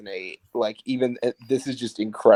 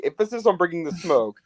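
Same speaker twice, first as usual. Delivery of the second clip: ordinary delivery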